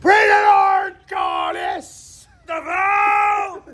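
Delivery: shouted out high and loud for the echo. Real, not acted. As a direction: call it out across the valley. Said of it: "y a de l'écho ici".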